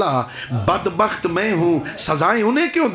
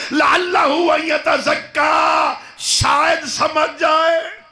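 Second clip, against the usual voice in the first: full-force preaching; 9 semitones higher, slower than usual. Acted. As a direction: preach it out with full force, voicing God's command. God addresses Moses, preached at force.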